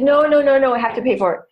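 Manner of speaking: loud hurried no, no, no; flustered refusal. Retold or imitated. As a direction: protest a free drink too eagerly. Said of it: "I could have said".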